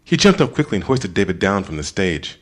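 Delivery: usual speaking voice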